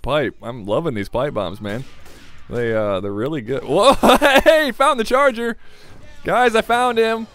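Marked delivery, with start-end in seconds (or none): 3.6-7.3 loud, high laughing shout; excited alarm. Real, not acted. Player shouting to teammates that he found the charger, excited alarm. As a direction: yell out the surprise find, much higher and louder.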